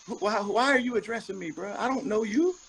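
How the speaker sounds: rising, baffled retelling of his own reaction; incredulous retold reaction. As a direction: play it back like you still can't believe it. Retelling reaction to being addressed.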